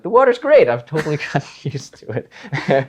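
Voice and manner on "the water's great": gushing. Retold or imitated. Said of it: "I'm like".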